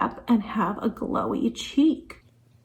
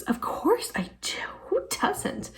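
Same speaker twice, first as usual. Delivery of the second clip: breathy higher whisper; whispered aside; 10 semitones higher, 8 dB quieter than usual. Acted. To viewers about loving romantic movies, a whispered aside. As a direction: let it slip out as a secret.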